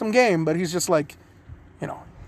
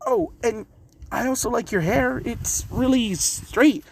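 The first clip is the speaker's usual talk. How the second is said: put-on high, slow boyish voice; a bright kid drawling a compliment; Naruto voice; 7 semitones higher than usual. Naruto complimenting the blonde girl's hair, high and slow. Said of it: "Naruto's just like".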